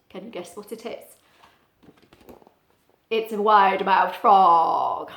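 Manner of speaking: ordinary delivery, nothing marked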